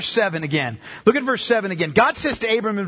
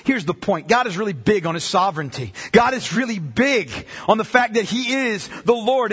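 ordinary talk, nothing marked

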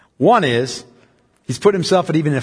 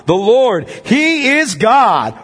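higher, very slow triumphant cry; rising exultant proclamation; 6 semitones higher, 3 dB louder, much slower than usual. Acted. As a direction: lift it to an ecstatic peak. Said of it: "fell on their faces and said".

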